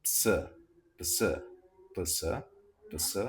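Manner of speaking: ps repeated with a clear P before the S; teacher drilling the ps cluster. Shown as a demonstration. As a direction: sound out ps again and again. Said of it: "Let's fuse them together".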